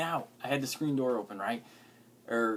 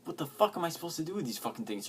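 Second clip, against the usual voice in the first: ordinary talk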